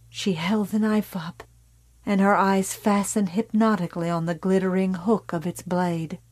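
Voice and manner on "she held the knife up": ordinary delivery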